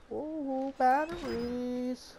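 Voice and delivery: high, slow excited ooh; drawn-out delighted ooh. Real, not acted. Excited at finding batteries.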